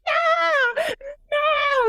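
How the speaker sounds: high-pitched mock scream; imitated scream. Retelling the film heroine screaming at the murder, mock scream. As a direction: scream it like a slasher victim, hammed up. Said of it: "And she's screaming".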